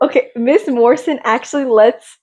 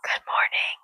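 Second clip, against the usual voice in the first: very soft, whispered greeting; 12 dB quieter than usual. Pretend. Child told not to shout into the microphone, whispering. Huwag kang sisigaw sa microphone.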